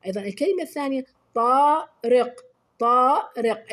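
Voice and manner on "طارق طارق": "طارق" with a long, loud "طا"; stretched alif. Shown as a demonstration. Teacher giving pupils a second example, stretched alif. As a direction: hold the first vowel of "طارق", then finish short. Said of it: "أيضًا مد الألف".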